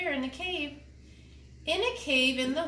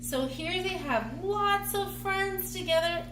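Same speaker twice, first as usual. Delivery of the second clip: high, cheerful telling; light up as you say it; bright excited aside; 8 semitones higher than usual. Real, not acted.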